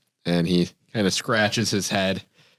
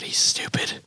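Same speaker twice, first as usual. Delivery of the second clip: whispered aside; slower than usual. Real, not acted. Whispered aside about dog.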